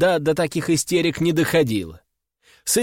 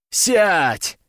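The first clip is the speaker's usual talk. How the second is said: sharp command; 3 semitones higher than usual. A narrator's reading. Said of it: "прикрикнул на него".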